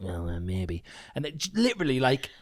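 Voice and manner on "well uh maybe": low, soft reluctant concession; mock sheepish mumble; imitated grudging doubt. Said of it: "you were like".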